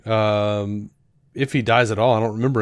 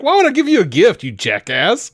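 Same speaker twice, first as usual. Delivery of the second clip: high, fast and laughing, a jokey snarl; laughing mock scorn; 9 semitones higher, 5 dB louder than usual. Laughing while quoting Cain's scornful reply to Abel. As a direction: giggle through the insult.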